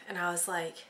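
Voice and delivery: ordinary talk, nothing marked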